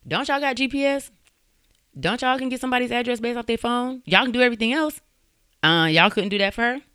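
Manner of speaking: high-pitched, incredulous questioning; indignant challenge. Real, not acted. Host challenging the police excuse.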